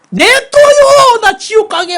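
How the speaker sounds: high, loud yell of defiance; defiant shout. Retold or imitated. Young man yelling defiance at his family. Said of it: "막 내가 막 그랬거든요".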